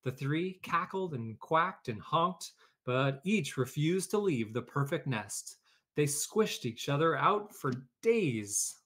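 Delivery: lively read-aloud storytelling voice; story narration. A narrator's reading. Teacher reading a picture book to her class, lively storytelling.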